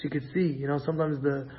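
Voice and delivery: ordinary talk, nothing marked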